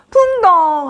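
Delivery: high, loud "풍덩"; voiced splash. Storyteller as Peter jumps onto the water, loud high splash word. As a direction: exclaim the splash as you jump.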